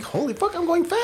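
high breathless thrill; excited. Retold or imitated. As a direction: squeal it, thrilled by speed. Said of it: "It's like".

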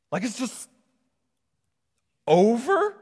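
high, questioning disbelief with a long pause; hesitate, then lift "over" into a baffled question; incredulous. Acted. Preacher questioning Jesus about his final words, incredulous.

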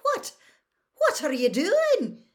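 high, loud, stammered question; appalled. A narrator's reading. McGonagall sees the ferret flying, appalled. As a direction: sputter it in high-pitched disbelief.

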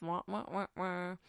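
droning wah wah blah noise; softly droned wah wah wah; mocking wah wah. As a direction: imitate boring chatter dismissively. Mocking his boring talk.

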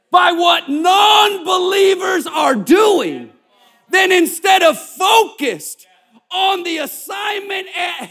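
high, loud preacher reading scripture, slowed; preaching at full force. Acted. Pastor preaches the verses to the church at full force.

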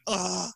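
high, mock-wounded groan; retold moan of pain. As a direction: groan as if nursing a cut. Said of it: "kind of bleeding. I'm like".

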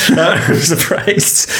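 laughing, slow mock surprise; drawn out. Real, not acted. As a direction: stretch the words out in amused disbelief. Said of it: "I'm surprised when I read that out".

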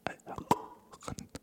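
soft breathy ASMR whisper into the mic; drawn-out mock ASMR whisper. Shown as a demonstration. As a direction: whisper slowly and close, like an ASMR video. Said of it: "Okay, das war Cocksucking".

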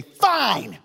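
high, emphatic mock defiance; defiant retort. Preacher voicing kids refusing the dump, mock defiant. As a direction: throw it back high, like a stubborn kid.

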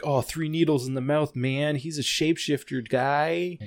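a hint of a spaced-out stoner; drugged-out woman. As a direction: play her as a mildly dazed druggie. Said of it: "dosed up on a bunch of drugs".

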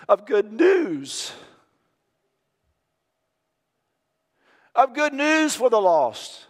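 soaring high preaching voice. Acted. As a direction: lift it up loud and high, proclaiming. Preaching climax, good news.

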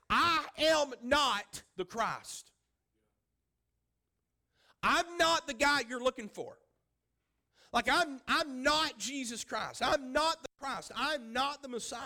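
declaring it with force; emphatic denial. Retold or imitated. Preacher voicing John to the crowd, loud emphatic denial.

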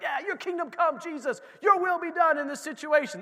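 high, loud, fired-up prayer; eager believer. Retold or imitated. Believer praying over a situation, fired-up and high. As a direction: claim it like a zealous believer.

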